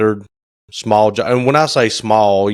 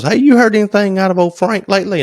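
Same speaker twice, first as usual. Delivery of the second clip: higher mock wife voice, lightly put on; imitating his wife; 10 semitones higher than usual. Husband mimics his wife asking about Frank, higher voice. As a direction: ask it the way his wife asked. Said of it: "my lovely bride asked me".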